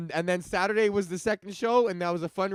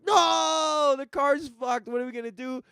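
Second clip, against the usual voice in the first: high panicky yelp, mock alarm; hammed-up panic, voice jumping high; panicked; 9 semitones higher, 5 dB louder than usual. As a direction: play the stranded guys losing it over the car. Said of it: "So we're like".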